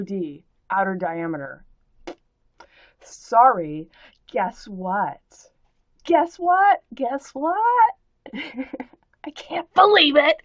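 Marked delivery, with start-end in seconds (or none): 6.0-10.4 higher and louder, hammed-up glee; mock excitement. To the commenter, hammed-up excitement. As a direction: overplay the thrill for the troll. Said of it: "Is this enough excitement for you?".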